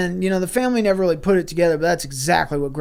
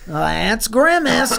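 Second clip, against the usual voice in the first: higher, drawn-out sitcom-style line; mock catchphrase; 6 semitones higher, much slower than usual. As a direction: stretch out each word like a catchphrase. Family's quoted catchphrase about Grimace.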